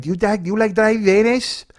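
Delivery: high, loud mock Mexican accent; heavy Mexican accent. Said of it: "she had a really heavy accent".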